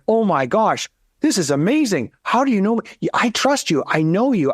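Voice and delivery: high, gushing client voice, mock awe; amazed client. Advisor mimicking a thrilled client's gushing reaction. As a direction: play a client blown away and gushing. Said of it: "They're like".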